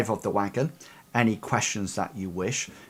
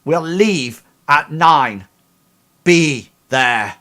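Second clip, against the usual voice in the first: loud, slow, firm call; 4 semitones higher, 10 dB louder, slower than usual. Acted. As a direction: call it out across the room. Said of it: "she calls out".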